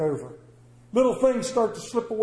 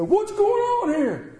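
high exasperated cry; 14 semitones higher, 3 dB louder, slower than usual. Pretend. Preacher mocking a frustrated driver with a high slow wail. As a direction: shoot way up in pitch, slow and baffled. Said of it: "step back and go".

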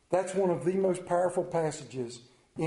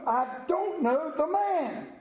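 high, drawn-out insistent denial; emphatic oath; 11 semitones higher, slower than usual. A narrator's reading. Peter denies Jesus again to onlookers, emphatic oath. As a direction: swear it slowly, pitched well up. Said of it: "and with an oath".

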